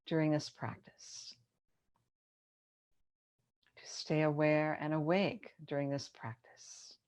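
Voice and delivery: unhurried yoga nidra guidance with long pauses; measured, spacious relaxation-teacher delivery; guided meditation. Acted. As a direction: ease them into rest, phrase by phrase.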